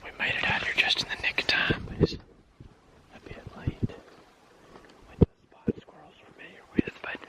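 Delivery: hushed whisper in the woods; hunting whisper. Real, not acted. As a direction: whisper so the birds can't hear.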